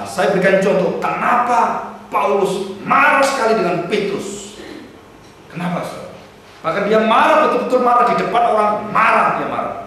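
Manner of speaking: fiery pulpit delivery building up; preaching at full force. Acted. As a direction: preach it hard and urgent. Passionate preaching on Paul's anger.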